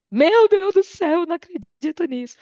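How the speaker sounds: high, loud cry of dismay; mother on the phone hearing the cat ran off, shocked cry. Retold or imitated. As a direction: act out mom gasping in disbelief. Mother hears the cat fled.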